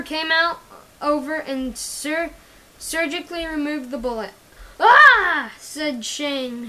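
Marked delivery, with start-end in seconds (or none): 4.8-5.5 sharp, loud, higher yelp of pain; cry of pain. Acted. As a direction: yelp as the bullet comes out. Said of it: "who was in pain".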